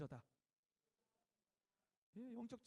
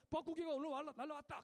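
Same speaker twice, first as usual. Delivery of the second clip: louder, higher call-out; password call; 5 semitones higher, 5 dB louder than usual. Retold or imitated. Sentry calling out the password, mild mock call. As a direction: call the code word like a guard. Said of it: "그쪽 얘기해요".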